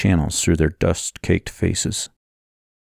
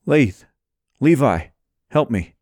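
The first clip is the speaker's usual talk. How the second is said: urgent, slightly raised call; calling for help; 6 semitones higher than usual. Acted. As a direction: call out to friends for help, pitched up. Calling friends for help amid wreckage.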